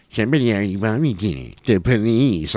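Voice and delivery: mocking kid voice, words mushed; childish, garbled mocking singsong; Fun Boy. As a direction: mimic it back like a bratty child. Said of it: "almost like he's ... a kid, too".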